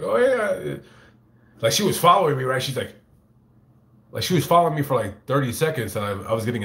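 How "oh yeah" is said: excited fan. Retold or imitated. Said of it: "She was like".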